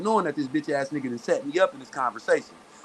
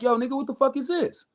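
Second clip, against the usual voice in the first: high-pitched retold protest; indignant complaint; 10 semitones higher than usual. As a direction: act out an incredulous phone complaint. Acts out indignant phone complaint.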